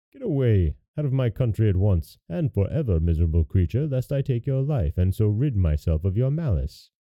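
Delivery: ordinary delivery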